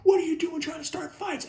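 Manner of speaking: high-pitched mock scolding scream; hammed-up high scolding; screaming teacher. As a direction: imitate a teacher shrieking a telling-off. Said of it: "started screaming at me".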